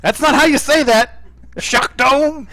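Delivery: high, loud protest; mock indignant yell. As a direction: holler a correction in mock outrage. Mock outrage at announcer's delivery.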